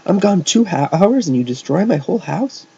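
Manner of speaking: high, loud scolding; demanding. A narrator's reading. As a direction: scold them in disbelief. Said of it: "Agnes demanded".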